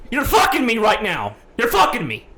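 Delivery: loud, high, outraged disbelief; furious rant. Real, not acted. Ranting to a friend about the clerk, furious.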